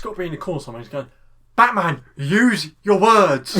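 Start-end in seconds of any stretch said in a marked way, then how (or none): 1.6-3.6 loud, higher, drawn-out mock coaching call. Cornerman urging Batman to talk it out, mock loud call. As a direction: call it slowly, stretching every word.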